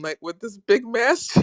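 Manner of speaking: high, dissolving in laughter; cry-laughing. Real, not acted. Describing the friend's mask, cry-laughing.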